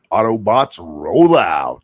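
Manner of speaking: deep, slow robot leader voice; Optimus Prime voice. Retold or imitated. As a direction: boom it like a heroic robot commander.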